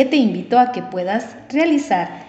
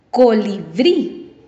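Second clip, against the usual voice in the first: "Colibrí" slowly sounded out, force on final "brí"; stress on last syllable; much slower than usual. Shown as a demonstration. Teacher showing the last-syllable rule, slow stressed "brí" in "Colibrí". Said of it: "Esta lleva la fuerza de voz".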